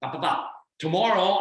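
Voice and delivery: ordinary delivery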